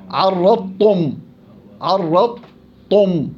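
عرضتم with ض swallowed into ت, very slow; slow assimilated عرضتم; merged daad. Shown as a demonstration. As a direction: drop the ض in عرضتم, slowly. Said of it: "أبصر كيف بجيبوها".

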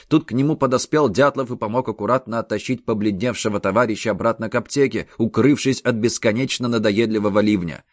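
ordinary delivery, nothing marked

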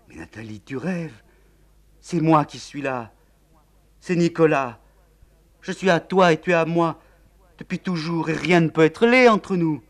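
high, loud, tender urgency; young man insisting on their love; ardent insistence. Acted. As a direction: reassure her fiercely. Nicolas insists.

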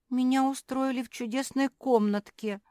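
high, loud whimper. A narrator's reading. Naretta mourning her lovely room, whimpering. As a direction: whine like a spoilt little dog. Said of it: "захныкала Наретта".

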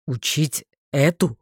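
low, slow male voice; low, drawn-out disbelief; lightly voiced man. A narrator's reading. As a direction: voice a man balking at the idea. Male character reacts in disbelief.

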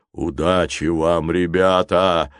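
slow giant's goodbye; farewell. A narrator's reading. Giant says farewell to children, slow and solemn. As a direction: call a solemn, drawn-out goodbye. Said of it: "попрощался с ними великан".

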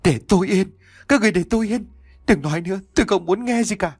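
high-pitched, anguished mild shout; choked yelling. A narrator's reading. Long begs the voices to stop, high choked yelling. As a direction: cry out as if choked with frustration. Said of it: "hét lên những tiếng ức nghẹn nơi cổ họng".

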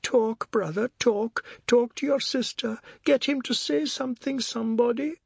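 high-pitched coaxing; high, pleading concern; concerned woman. A narrator's reading. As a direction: urge him gently to speak, pitched high. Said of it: "Her voice held pride and genuine concern".

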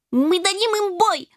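high-pitched kitten battle cry; cocky bravado. A narrator's reading. Ginger pulling out his sword, cocky high voice. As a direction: puff up and declare it boldly. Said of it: "запетушился Рыжий".

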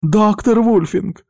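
high, drawn-out cry of alarm. A narrator's reading. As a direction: call out in a high, frightened woman's voice. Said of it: "закричала Симона". (встревоженный высокий крик)

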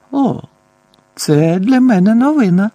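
high, loud sarcastic retort; irritated scoff. A narrator's reading. Boy retorts to his accusers, scoffing with rising irritation. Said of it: "гмикнув Гаррі, починаючи дратуватись".

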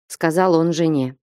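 ordinary delivery, nothing marked

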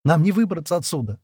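ordinary delivery

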